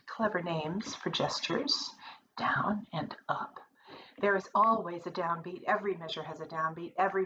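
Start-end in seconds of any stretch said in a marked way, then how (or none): 2.4-3.6 breathy whispered aside. Real, not acted. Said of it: "whispered aside on gestures".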